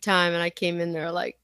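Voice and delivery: ordinary talk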